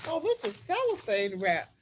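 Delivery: usual speaking voice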